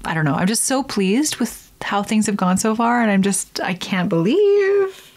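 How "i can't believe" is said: much higher, slow amazed; excited disbelief. Real, not acted. Nearly done with veneers, high slow amazement. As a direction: savor the disbelief. Excited near the end result.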